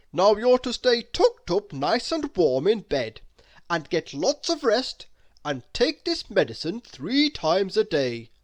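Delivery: lightly voiced doctor laying down the rules. Doctor giving orders.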